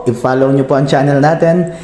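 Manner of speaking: loud, high-energy host delivery; YouTube channel intro. Acted. Host urging viewers to subscribe to the channel, energetic intro voice. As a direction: punch it out like an upbeat channel opener.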